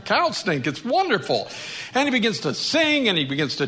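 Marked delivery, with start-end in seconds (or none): 0.0-1.5 higher, gushing mock delight; overjoyed bird basking in the warmth; happy little bird. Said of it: "he gets all happy".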